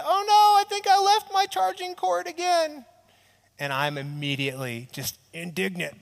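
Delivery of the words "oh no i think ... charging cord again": much higher, louder call of dismay from across the house; wife calling out. Retold or imitated. Husband imitating his wife calling across the house, high and dismayed.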